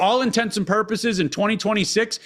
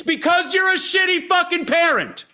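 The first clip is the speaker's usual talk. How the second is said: emphatic high rant; 12 semitones higher than usual. Real, not acted. Host telling guests bad parents are to blame, high emphatic rant. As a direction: voice jumps way up, pushed hard. Ranting host makes his point.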